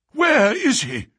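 high, loud, urgent gasp; gasped question. A narrator's reading. Duke hears that his son is found, gasping. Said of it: "he gasped".

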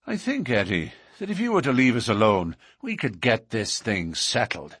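ordinary delivery

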